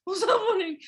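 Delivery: mock confused dog through laughter; confused old dog. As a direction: play the bewildered old dog. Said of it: "stand up like".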